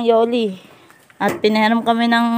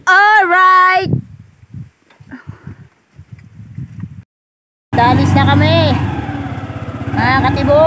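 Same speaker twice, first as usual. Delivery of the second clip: excited sign-off; 5 semitones higher than usual. Acted. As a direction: cheer it out to viewers. Vlog sign-off, calling out.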